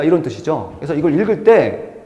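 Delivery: ordinary delivery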